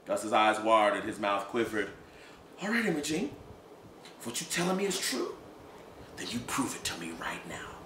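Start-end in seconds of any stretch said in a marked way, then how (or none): 2.6-7.8 cold quiet demand. Acted. Gus demands proof quietly.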